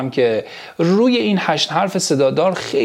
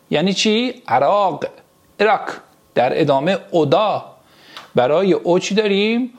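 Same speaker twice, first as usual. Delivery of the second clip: ordinary talk, nothing marked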